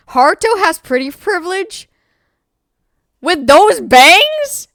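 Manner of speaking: scandalised high-pitched questioning; incredulous reaction. Real, not acted. Reacts incredulously while reading chat.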